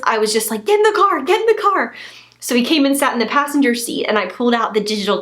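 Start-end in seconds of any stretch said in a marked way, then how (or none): 0.6-1.9 urgent excitement. Retold or imitated. Urgent retold order to husband.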